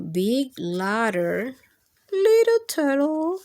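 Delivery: gentle, sing-song picture book reading; lilting read-aloud to kids; reading to children. Acted. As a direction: read it like bedtime story time for little ones.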